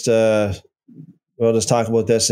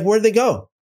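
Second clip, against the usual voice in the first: ordinary talk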